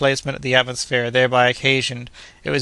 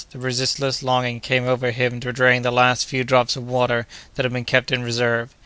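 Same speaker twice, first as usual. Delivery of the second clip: usual speaking voice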